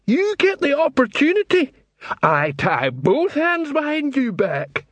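very high, slow troll voice; belligerent troll. Acted. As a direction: pitch it right up and drag it out like a troll spoiling for a fight. Troll answers the challenge.

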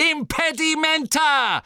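very high shouted spell; Harry, screaming. A narrator's reading. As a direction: scream the incantation to stop an attacker. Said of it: "schrie Harry".

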